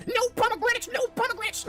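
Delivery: shrill caricature of a lecturing lady; mocking class lady. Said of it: "lady doing a demonstration in class where she's like".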